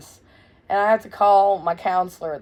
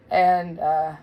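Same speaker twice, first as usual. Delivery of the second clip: usual speaking voice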